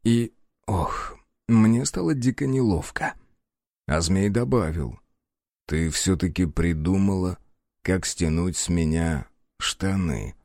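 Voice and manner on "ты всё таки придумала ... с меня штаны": low teasing male voice. Acted. Snake character teasing heroine.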